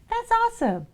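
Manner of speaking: very high thrilled soap voice; delighted pretty soap. Acted. Pretty soap thanks ugly soap, very high delight. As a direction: gush it way up high.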